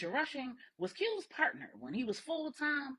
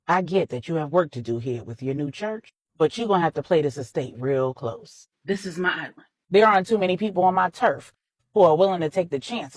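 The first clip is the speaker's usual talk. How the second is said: put-on a lightly lowered, firm older man; Manny, firm and protective; 4 semitones lower, 10 dB louder than usual. A narrator's reading. Manny warns Corey his island is safe, lightly voiced and firm. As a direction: lay down the law on his own turf, steady.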